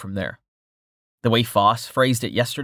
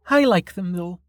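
put-on higher, dry teasing young woman; Melka voice; 8 semitones higher than usual. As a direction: tease him in a higher voice. Melka's voice in the story.

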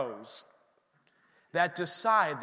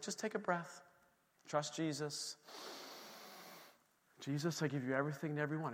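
much softer, gentle urging; calm soft advice; 3 semitones lower, 12 dB quieter than usual. Retold or imitated. His wife Liz calming him after his woes, much softer. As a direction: talk him down quietly. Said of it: "But she was like".

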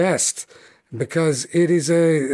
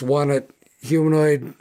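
ordinary talk

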